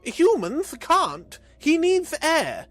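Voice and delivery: high, loud and slow, desperate; alarmed plea. A narrator's reading. Vrodax pleading with the Abyssals, high alarmed cry. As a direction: beg them to see the danger.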